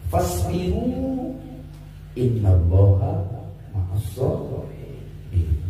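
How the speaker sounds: slow chanted Arabic verse; preacher reciting scripture to the congregation; Quran recitation. Acted. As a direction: recite the verse with drawn-out vowels.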